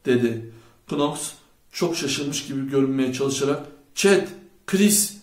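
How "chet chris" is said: two loud, higher calls; raised-voice exclamation of names; shouting. A narrator's reading. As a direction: call out each name separately. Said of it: "diye bağırdı".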